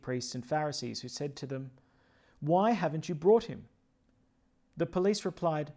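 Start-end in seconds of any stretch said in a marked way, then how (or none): none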